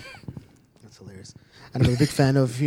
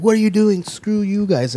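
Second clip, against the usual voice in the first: higher, louder mock outrage; retold angry protest; 6 semitones higher, 4 dB louder than usual. Arrested partygoers to the police, indignant retelling.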